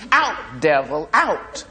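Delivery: sharp exorcising order, rising; ordering the devil out. Acted. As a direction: cast the devil out like a church mother. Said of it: "one old lady ordered".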